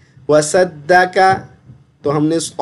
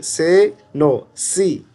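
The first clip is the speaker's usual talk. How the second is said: normal talking voice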